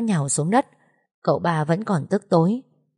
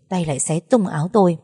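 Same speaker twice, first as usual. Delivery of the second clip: usual speaking voice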